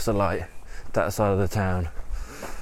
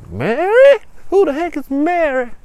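loud, very high incredulous query; baffled outburst; 8 dB louder than usual. Acted. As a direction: shoot the pitch up in bafflement. Father puzzled by the name.

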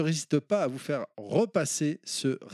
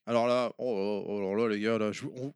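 normal talking voice

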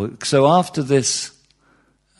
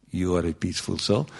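lower, softer affirmation; soothing meditation voice; 6 semitones lower, 6 dB quieter, faster than usual. Acted. Centre leader to visitors over squishy music, soothing murmur. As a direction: lull them with a low, soft voice.

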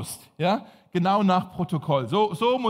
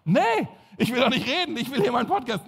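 annoyed; 4 semitones higher than usual. Retold or imitated. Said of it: "annoyed reply to interrupting coworker".

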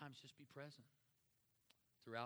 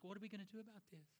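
high, fast, fretful question; mock fretting God; 10 semitones higher, faster than usual. God turns to Jesus in mock panic, high and hurried. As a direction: play it as a flustered boss asking for help. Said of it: "Looks at Jesus".

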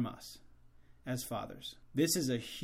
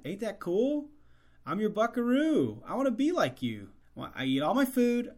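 put-on higher, eager little-boy voice; young son; 6 semitones higher, 3 dB louder than usual. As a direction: play an admiring son talking to Dad. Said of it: "he hears his son in the back".

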